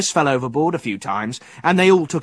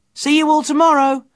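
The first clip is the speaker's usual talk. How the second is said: high, loud farewell; raised boy's voice calling goodbye; 11 semitones higher, 5 dB louder than usual. A narrator's reading. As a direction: call cheerily across the quay. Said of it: "called Fergus".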